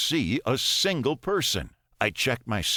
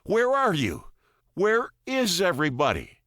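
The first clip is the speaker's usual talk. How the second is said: breathless, higher, louder; gasping; 9 semitones higher, 4 dB louder than usual. A narrator's reading. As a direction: gasp it out in alarm. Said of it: "I gasp".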